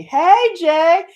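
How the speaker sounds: high, loud excited hail; calling out. Real, not acted. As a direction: shout a greeting at a friend. Calling out to a viewer.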